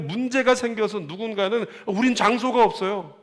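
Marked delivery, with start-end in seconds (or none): 1.9-3.1 complaining members. Retold or imitated. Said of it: "이렇게 얘기하는 사람들이".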